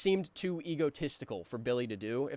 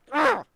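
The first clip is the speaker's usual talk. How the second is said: loud exasperated ugh; 12 dB louder than usual. Retold or imitated. Exasperated groan.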